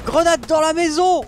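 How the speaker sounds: high, slow alarmed shout; grenade warning yell. Real, not acted. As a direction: yell it so everyone scatters. (cri d'alerte grenade)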